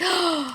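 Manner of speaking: imitated gasp of admiration; delighted gasp. Writing group reading a last line, delighted gasp. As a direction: suck in a breath, mock-amazed.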